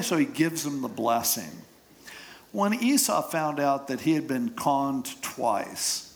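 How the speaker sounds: ordinary delivery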